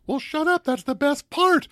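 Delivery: very high-pitched playful protest; mock indignant retort. Acted retort to late moviegoers.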